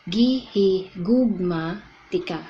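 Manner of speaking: "Gihigugma tika" said slowly, every syllable clear; slow teaching demo of "Gihigugma tika"; Cebuano pronunciation. Shown as a demonstration. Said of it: "And the first one is".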